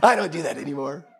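lightly sanctimonious put-on voice; mock self-righteous. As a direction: repeat it with mock piety. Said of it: "no tinge of self-righteousness in my voice".

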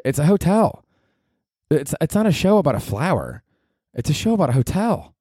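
a hint of whiny indignation; mock outrage. Ranting at society over the show's name, mock indignant.

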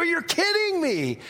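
high, wailed disbelief; hammed-up high-pitched amazement; disbelieving contestant. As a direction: imitate an overwhelmed prize winner. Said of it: "And you go".

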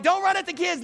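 high mock scolding wife; wife scolding dad, lightly voiced; mock mom scolding. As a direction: snap it like mom. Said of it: "Mom's like".

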